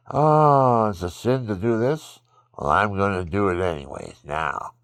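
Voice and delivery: loud, high, sneering mock defiance; brash put-on voice of a defiant sinner. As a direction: ham up a sinner who sins out of spite.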